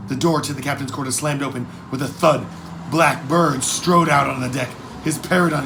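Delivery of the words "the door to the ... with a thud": ordinary delivery, nothing marked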